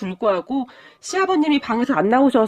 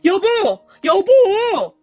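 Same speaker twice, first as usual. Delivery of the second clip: shouting for husband; 8 semitones higher, 4 dB louder, much slower than usual. Acted. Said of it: "소리를 질러댔고".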